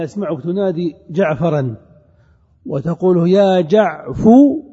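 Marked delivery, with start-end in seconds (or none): 3.3-4.6 loud, drawn-out "jaʿfu" for "جعفو", rising; damma on ف. Shown as a demonstration. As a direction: stretch "جعفو" and end firmly on "fu". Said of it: "الفاء مضمومة". (نطق "جعفو" بصوت عالٍ مع ضم الفاء)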